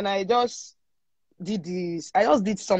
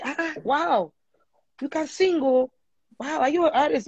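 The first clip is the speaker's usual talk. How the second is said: raised-pitch gushing as if amazed; impressed gushing; 6 semitones higher than usual. Retold or imitated. People praising her singing, pitched-up gushing. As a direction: mimic strangers marvelling at her voice.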